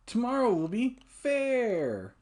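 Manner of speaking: hammed-up forecaster, drawn out; mock weather announcer. Reading weather report again.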